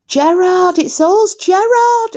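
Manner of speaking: high, loud yell out a car window; calling out. Retold or imitated. Fan hanging out of a car window yelling to a star, high loud call. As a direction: shout his name up high to get his attention. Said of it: "I'm hanging out of the window, and I'm going".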